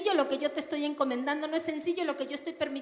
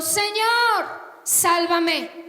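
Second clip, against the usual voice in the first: loud cry for rescue; 4 semitones higher, 8 dB louder, much slower than usual. Acted. Peter sinking, crying out to the Lord, loud and high. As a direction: call out to be saved, high and loud. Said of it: "dio voces diciendo".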